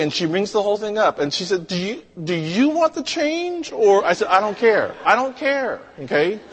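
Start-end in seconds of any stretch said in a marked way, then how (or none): 1.7-3.7 high, hesitant question; tentative disbelief, much higher; bewildered cashier. Retold or imitated. Cashier hesitantly asks about change. 4.3-6.4 high, whiny protest; hammed-up self-important weariness; mock exasperation. Mock impatience in retold inner speech.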